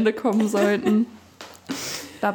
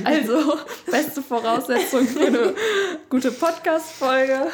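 ironic, through laughter; laughing; 5 semitones higher, 6 dB louder, slower than usual. Real, not acted. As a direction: laugh it out sarcastically. Ironic remark while laughing.

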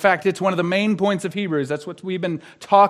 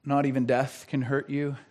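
ordinary talk

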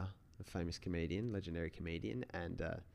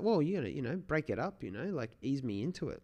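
exasperated owner; 7 semitones higher, 4 dB louder than usual. Retold or imitated. Owner scolds neighbor's bluntness.

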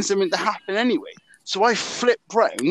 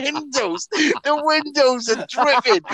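usual speaking voice

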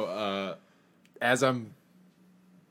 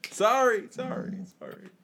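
fading echo call; 11 semitones higher, slower than usual. Acted. Calls out, echo fades away.

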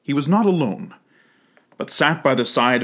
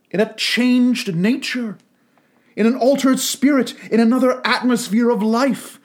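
put-on young girl, sorrowful; 8 semitones higher than usual. Girl voiced in the story.

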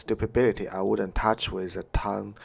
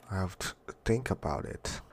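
ordinary talk, nothing marked

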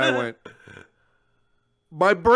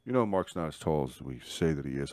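confiding; 13 semitones lower, 9 dB quieter than usual. Retold or imitated. Wrestler confiding a secret, low and soft. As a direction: lower and softer secret.

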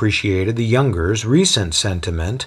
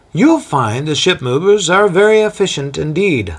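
a lightly voiced boastful local guide, higher; proud Corinthian guide; 7 semitones higher, 4 dB louder than usual. Boastful guide telling a visitor about Corinth, lightly voiced. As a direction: show off the city to a newcomer. Linus showing Corinth to guest.

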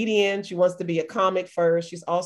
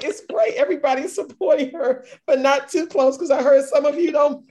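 higher and louder, giggling through the quote; laughing through the repeated post; laughing retelling; 7 semitones higher, 4 dB louder than usual. Retold or imitated. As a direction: retell it while cracking up. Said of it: "he was like".

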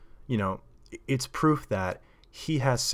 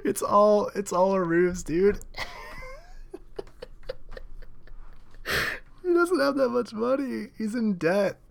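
high, squealing talk through laughter; helpless cackling between phrases; real laughing fit; 12 semitones higher than usual. Real, not acted. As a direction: squeeze the words out while cracking up. Cracking up at own joke.

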